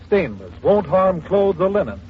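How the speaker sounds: normal talking voice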